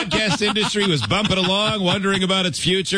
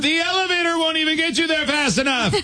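retold yell; 12 semitones higher than usual. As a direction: imitate someone calling out after him, pitched high. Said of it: "And Maitland yelled".

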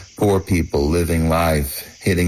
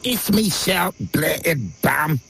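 woman's voice; 10 semitones higher, slower than usual. Retold or imitated. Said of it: "she immediately said".

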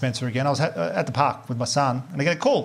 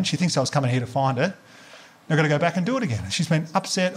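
normal talking voice

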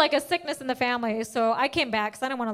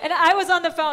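ordinary talk

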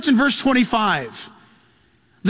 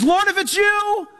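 eager Peter; 6 semitones higher than usual. Retold or imitated. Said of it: "and he says".

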